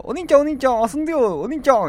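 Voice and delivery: clingy little sister. Pretend. Imaginary little sister in his throat begging, clingy and high. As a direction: whine like a little sister wanting attention. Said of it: "言うてね".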